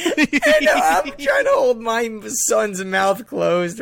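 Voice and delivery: high, loud and slow through laughter; barely holding it together while telling it; laughing as you speak; real laughter. Real, not acted. Cracking up while telling the story.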